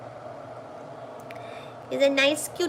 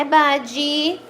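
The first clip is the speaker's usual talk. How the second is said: ordinary delivery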